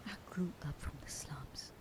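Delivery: whispered memory of a rough youth. Acted. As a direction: hush it like an old hurt. Confessing a hard past.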